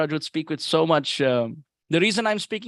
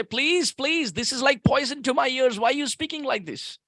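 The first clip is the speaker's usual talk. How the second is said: pleading; 6 semitones higher than usual. Retold or imitated. Haridas begs Sanatan to stop.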